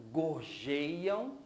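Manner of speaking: "gorjeiam" drawn out, leaning on "jei"; sílaba tônica. Shown as a demonstration. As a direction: stretch the strong middle syllable of "gorjeiam". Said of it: "gei seria a sílaba mais forte". ("gorjeiam" devagar, com "jei" alongado)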